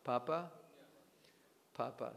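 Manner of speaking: usual speaking voice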